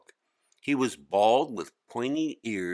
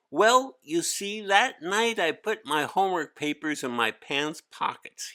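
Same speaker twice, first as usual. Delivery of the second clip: put-on much higher, louder boy's whiny complaint; a kid groaning under a heavy load; young boy Hari; 8 semitones higher, 3 dB louder than usual. Hari making excuses.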